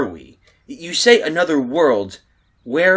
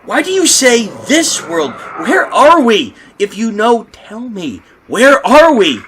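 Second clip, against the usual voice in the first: loud, higher, frantic; panicked demand; 7 semitones higher, 7 dB louder than usual. Acted. Man demands answers, panicking.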